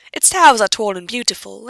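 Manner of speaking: ordinary delivery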